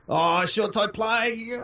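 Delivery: sudden drawn-out excited cry; excited shout. Real, not acted. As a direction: call the play out in a thrill. Watching a live rugby game.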